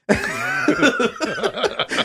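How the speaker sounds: squealing laugh; high burst of laughter. Real, not acted. Laughing after the quote.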